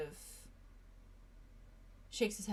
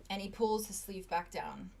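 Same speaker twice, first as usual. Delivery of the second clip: normal talking voice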